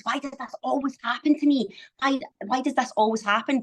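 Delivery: mock exasperated. Someone hit by the same problem repeatedly, mock exasperated. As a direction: play a mildly exasperated "why me" plea.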